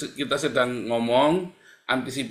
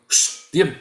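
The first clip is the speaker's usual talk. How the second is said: louder hushing command to family; sharp shush; 4 semitones higher, 6 dB louder than usual. Retold or imitated. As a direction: cut the complaint off with a firm shh. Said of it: "keluarga saya mau ada ngomong gitu". (menegur istri dan anak, menyuruh diam dengan keras)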